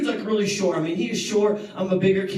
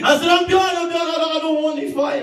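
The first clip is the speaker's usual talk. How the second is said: high, loud, gasping words; breathless surrender; 9 semitones higher, 4 dB louder than usual. Retold or imitated. Punched kid begging out, breathless and loud. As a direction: plead to stop while out of breath. Winded boy giving up.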